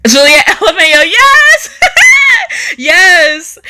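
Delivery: high squealing laugh over the comment; bursting out laughing. Real, not acted. Streamer reading a viewer's joke, laughing loudly. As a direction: crack up, then squeal yes.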